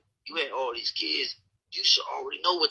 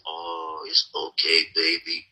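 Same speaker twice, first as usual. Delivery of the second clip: mock sweet pushover; 8 semitones lower, slower than usual. Mocking the nice guy women expect, in a lower, sugary voice. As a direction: lay it on like a doormat boyfriend. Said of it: "supposed to be this".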